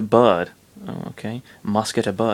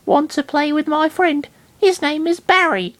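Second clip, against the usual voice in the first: put-on very high girly voice; sweet teasing girl. Girl showing friends her rat, very high sweet voice. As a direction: coo it like offering a pet.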